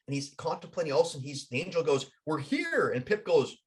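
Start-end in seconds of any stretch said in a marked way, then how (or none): 2.3-2.9 raised pitch, falling to a finish; announcing arrival. Acted. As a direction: announce it as the angel guide. Angel announces arrival to Pip.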